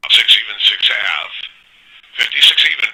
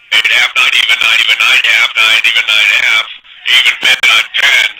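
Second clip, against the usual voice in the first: ordinary talk, nothing marked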